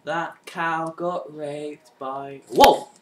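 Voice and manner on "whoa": a sudden, very loud cry of shock; startled whoa. Real, not acted. Gamer caught off guard mid-fight, loud startled cry. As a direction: jump at the shot and yelp it out.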